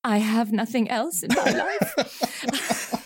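slow, laughing joke. Real, not acted. Podcast host asks what keeps her motivated, laughing. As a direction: say it through a laugh, self-mocking. Joking self-deprecation, laughing.

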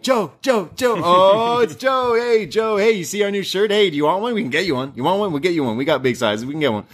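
put-on high, eager kid voices; hyped-up kids. Kids hyping their new shirts to Joe, high and eager. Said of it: "they get all hyped and they're like".

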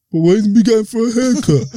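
put-on high-pitched child whining; high little kid voice. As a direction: ask like a confused small kid. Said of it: "I'm like".

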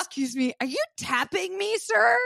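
high-pitched mock outrage, rising at the end; mock indignation. Acting out indignant reply to tapping.

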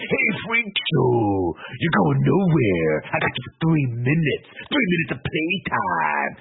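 high, very drawn-out wrestler taunt; Bonesaw. Acted. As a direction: stretch each threat like a hammed-up ring villain. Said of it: "this is where Bones says".

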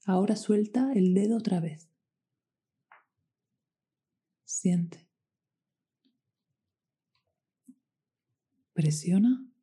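whispered press and release cues; guided meditation. Acted.

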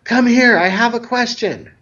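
high-pitched mock yell of a kid; retold child's yell. Boy calls from the living room, imitated high yell. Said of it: "finally decides to yell".

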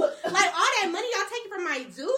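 high, loud laughing complaint about where the money goes; laughing rant. Real, not acted. To her friends about tuition, laughing high and loud. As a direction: cackle through the outrage, voice up high.

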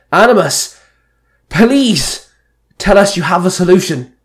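high, loud urgent plea; barked. A narrator's reading. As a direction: demand an answer, stretching the plea. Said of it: "Merrick barked".

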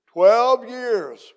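loud, high exclamation of amazement; amazed. Real, not acted. Visiting preacher reacts to the pastor's years of service, amazed. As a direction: echo it back in loud astonishment.

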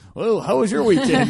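high, loud chirpy TV host; bubbly morning show host voice; talk show host. Retold or imitated. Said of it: "I feel like Regis and Kathy Lee".

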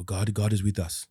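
a hint of an African accent, spoken with faith. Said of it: "That was a better accent".

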